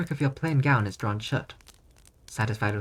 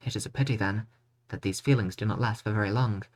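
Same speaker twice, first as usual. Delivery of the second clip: ordinary talk, nothing marked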